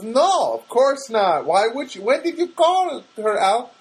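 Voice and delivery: high-pitched imitation of a Filipino therapist; mock Filipino accent, surprised questioning; Anna, bad Filipino accent. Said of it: "terrible Filipino accent".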